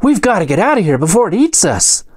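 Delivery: high, frightened halfling voice; panicked halfling cry. A narrator's reading. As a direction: urge escape in a high, alarmed voice. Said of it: "cries the halfling".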